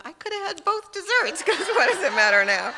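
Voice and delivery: high, loud, giggling quote; laughing mock thought. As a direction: laugh at your own absurd thought. Quoting her silly last thought.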